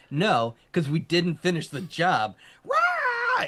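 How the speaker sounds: hammed-up battle cry ending in a high yell; mock patriotic shout. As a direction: shout it like a gung-ho soldier. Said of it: "And he's like".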